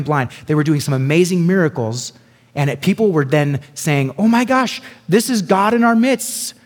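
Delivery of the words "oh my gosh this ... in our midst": high, loud amazed cry; crowd in awe. Retold or imitated. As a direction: gasp it out like a crowd seeing a miracle. Crowd worships Paul.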